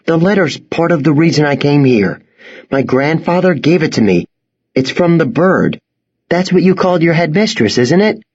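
put-on boy's voice. Boy explains.

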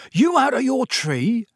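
high-pitched startled yelp; scandalized Cockney squawk; alarmed yelp. A narrator's reading. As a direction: yelp it in shock, pitch leaping up. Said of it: "yelped Stan".

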